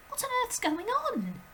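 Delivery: put-on high, urgent little girl's voice; a startled child calling out; high girl's cry; Matilda crying out. A narrator's reading. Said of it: "she cried".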